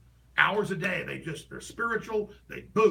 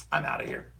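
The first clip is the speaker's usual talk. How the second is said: whispered quip. Retold or imitated. Quoting the critic leaving.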